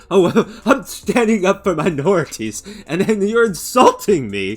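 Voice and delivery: hammed-up victim voice, laughing; mock self-righteous. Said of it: "Dan Slott does the same shit".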